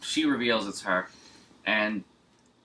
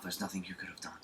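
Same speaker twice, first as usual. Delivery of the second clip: soft mock whisper, much softer; whispered comfort; 2 semitones lower, 12 dB quieter, faster than usual. Co-host mimicking the cop whispering comfort to the girl. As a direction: breathe it like a hushed reassurance.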